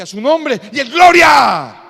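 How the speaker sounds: slow, loud pious shout; mock churchy proclamation. Clerk teases the believer with a loud, slow pious shout. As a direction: boom it out like a blessing.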